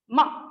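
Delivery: MA falling from high; quick downward drop on MA. Shown as a demonstration. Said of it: "ma with falling pitch".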